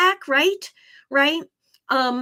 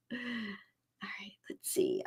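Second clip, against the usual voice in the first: whisper; 16 dB quieter than usual. Real, not acted. Muttering to self between readings.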